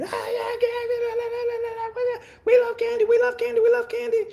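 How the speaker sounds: put-on high shrieking kid chant; screaming kids. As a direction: shriek it like sugar-crazed kids. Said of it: "Kids come in".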